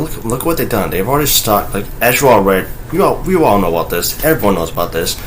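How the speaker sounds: ordinary talk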